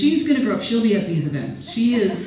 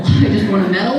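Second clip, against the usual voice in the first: I just want a medal said through a laugh, rising on medal; 3 semitones higher, 6 dB louder than usual. Retold or imitated. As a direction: giggle through the line. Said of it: "repeated with a laugh".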